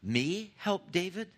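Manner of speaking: mock offended brother; indignant refusal. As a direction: scoff at the idea, drawn out. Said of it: "Shobai could have said".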